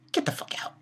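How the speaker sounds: a soft, unvoiced, contemptuous brush-off; whispered dismissal. Real, not acted. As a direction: whisper get the fuck out under your breath. Ranting at character Cohen.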